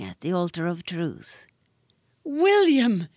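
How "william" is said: loud, high shocked cry; scream. A narrator's reading. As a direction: scream his name in horror. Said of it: "screamed Missus Brown".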